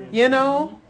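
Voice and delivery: high, loud wonder; exultant preaching. Real, not acted. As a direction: proclaim it to the congregation, amazed. Preacher marvels at Jesus standing.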